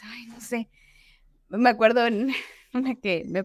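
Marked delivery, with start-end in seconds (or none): 0.0-0.7 a nervous little whisper to herself; whispered worry. Retold or imitated. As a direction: breathe it out unsure, no voice. Nervous at the doctor's office. (susurro nervioso)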